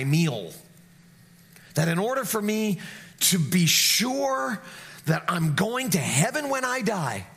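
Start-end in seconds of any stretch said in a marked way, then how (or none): none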